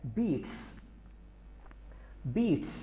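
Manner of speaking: बीट्स with a clear s at the end; बीट्स said with a final hiss; added s ending. Shown as a demonstration. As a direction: add the s to बीट्स. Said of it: "एस को इजाफ़ा करते हैं".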